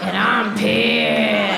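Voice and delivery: very slow, drawn-out mock fury; comic simmering anger. Wife reacting to husband's spill, drawn-out mock anger. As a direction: stretch it out, hammed-up and fed up.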